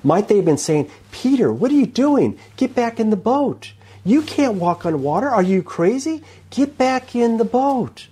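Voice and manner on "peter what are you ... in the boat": high, incredulous voices calling from the boat; mock the man on the water, much higher; doubting disciples. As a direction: scoffers in the boat calling Peter back.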